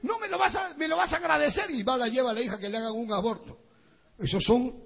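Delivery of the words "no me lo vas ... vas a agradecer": higher, hurried mock insistence; mother insisting. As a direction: push the daughter along, high and pressing. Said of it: "y dice".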